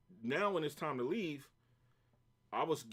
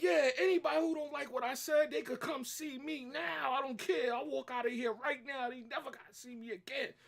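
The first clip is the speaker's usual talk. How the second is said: going crazy; 11 semitones higher than usual. Retold or imitated. Worker challenges boss's family at the door, going crazy. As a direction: call them all out, pitch way up. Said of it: "I was going crazy".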